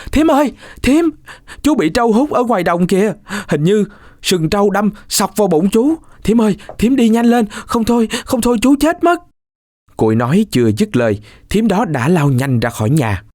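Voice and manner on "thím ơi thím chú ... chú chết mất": put-on much higher, frantic boy's cry; panicked shout. Cuội bursts in to fool his aunt, frantic shout. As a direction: yell it out like a panicked child. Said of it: "rồi kêu tướng lên".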